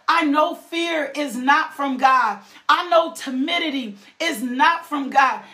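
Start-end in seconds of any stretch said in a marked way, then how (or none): none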